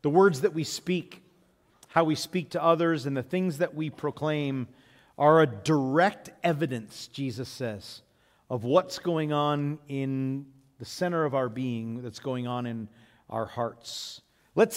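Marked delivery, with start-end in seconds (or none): none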